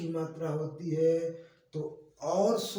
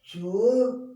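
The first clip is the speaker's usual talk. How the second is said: चोर called out much louder and higher; loud demo word; 4 semitones higher, 7 dB louder, much slower than usual. Shown as a demonstration. Teacher answering his own question, calling चोर loudly. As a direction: belt out चोर for the class. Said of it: "क्या लिखा है?".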